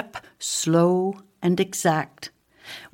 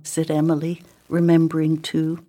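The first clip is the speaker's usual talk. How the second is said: usual speaking voice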